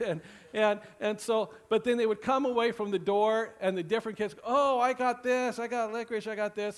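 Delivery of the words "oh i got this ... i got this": put-on higher, excited little kid showing off candy. Pastor voicing trick-or-treating kids, higher and excited. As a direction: brag like a kid with a full bag.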